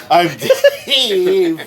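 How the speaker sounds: high, squeaky laughing intro; silly high voice. Pretend. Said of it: "And make your voice low".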